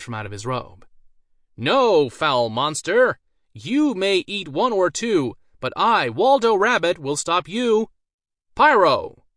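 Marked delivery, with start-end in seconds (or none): 1.6-9.2 loud, high, grand hero's challenge; hammed-up hero facing the ogre; heroic defiant shout. A narrator's reading. Mage defies ogre, casts spell.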